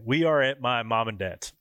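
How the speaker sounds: ordinary talk, nothing marked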